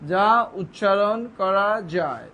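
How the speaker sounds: slow, deliberate words with gaps; slow dictation. Shown as a demonstration. Teacher defining a syllable, slow and deliberate. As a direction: dictate it word by word, drawn out.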